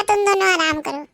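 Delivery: normal talking voice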